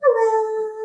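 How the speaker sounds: tiny high puppet voice; teddy bear voice. Pretend. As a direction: squeak it like a toy bear. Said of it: "Say hello, Blue".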